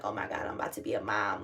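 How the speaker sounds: low, hushed inner thought; hushed awe. Retold or imitated. Thinking to herself about becoming a mom, hushed. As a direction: murmur it to yourself in disbelief. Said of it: "I'm just thinking".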